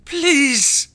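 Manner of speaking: put-on high, loud pleading child voice; a desperate kid begging to be taken along; loud high hiss of a frightened child. A narrator's reading. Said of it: "the child hissed".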